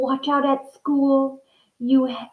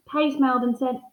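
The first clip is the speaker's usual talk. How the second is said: ordinary delivery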